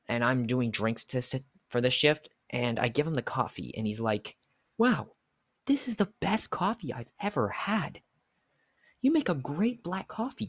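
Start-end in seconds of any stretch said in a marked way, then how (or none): 4.8-10.5 gushing customer. Retold or imitated. Imitates customer gushing over coffee.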